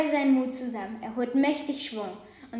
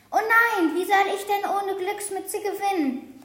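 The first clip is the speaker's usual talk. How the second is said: higher, louder cry of dismay; dismayed sheep; 6 semitones higher, 3 dB louder than usual. A narrator's reading. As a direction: wail about the lost cap. Sheep loses its lucky cap. (bestürzter Ausruf)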